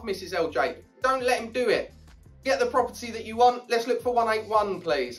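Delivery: normal talking voice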